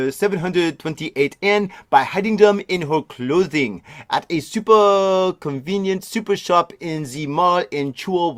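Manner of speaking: lightly put-on French accent, reading the news; a clumsy hint of French in every word; bad French accent. Reading the Tokyo news story in a weak French accent. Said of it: "Maybe we should do a French accent".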